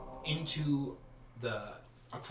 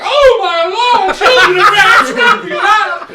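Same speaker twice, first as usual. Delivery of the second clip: very high, loud, laughing wail; panicked painting man; 21 dB louder, slower than usual. Acted. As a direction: squeal like a painted man on fire. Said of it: "he's like".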